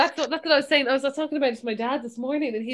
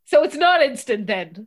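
louder, challenging question with a final rise; retold annoyance; 2 semitones higher, 7 dB louder than usual. Dad querying Instagram with daughter, mildly annoyed. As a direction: imitate a dad getting put out. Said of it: "And he was really so angry".

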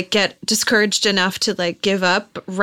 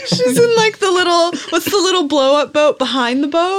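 laughing; 11 semitones higher, 3 dB louder than usual. Real, not acted. Laughing about sister in boat.

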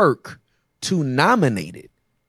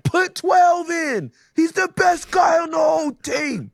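shouting proud dad in the stands, higher; hype dad; 14 semitones higher than usual. Retold or imitated. Dad yelling from the stands at his son's game. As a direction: yell from the bleachers like a proud dad. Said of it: "and you're like".

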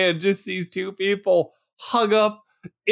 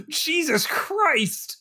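slow, drawn-out horrified gasp of a phrase; shocked; 8 semitones higher, 3 dB quieter, much slower than usual. Real, not acted. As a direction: let it sink in, appalled. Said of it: "horrified at the saran wrap".

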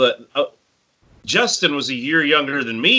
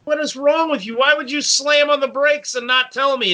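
high, mock indignant yell; indignant outburst; 10 semitones higher than usual. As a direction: demand an answer, offended. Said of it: "He's like".

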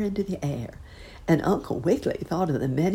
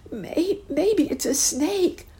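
put-on high, slow stammering child's voice; a scared child stumbling over words; high hesitant fearful boy; frightened boy; slower than usual. A narrator's reading. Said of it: "gasped the lost boy".

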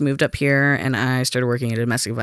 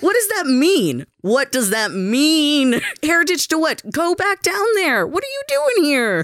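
incredulous; 12 semitones higher than usual. Retold or imitated. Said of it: "I'm like".

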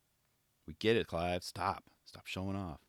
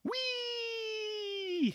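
put-on very high, slow, squealing kid-on-a-ride voice; giddy Clive; 7 dB quieter than usual. Clive shouting to kids on the rail, high slow squeal. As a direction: squeal it like a child on a merry-go-round. Voicing Clive's merry-go slide.

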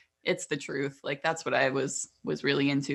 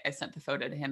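ordinary talk

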